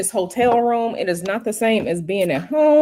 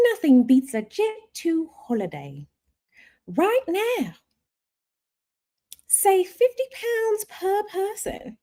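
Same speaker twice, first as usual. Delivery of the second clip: high, bright holiday-ad announcer imitation; Jet2 ad voice; 9 semitones higher than usual. Livestreamer mimicking the Jet2 holiday ad, announcer voice. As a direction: sell it like the holiday advert.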